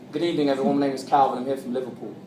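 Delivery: formal put-on English accent; British accent. Speaker greets everyone as from Liverpool, British accent. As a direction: introduce yourself like a refined Englishman. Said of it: "I talked like this".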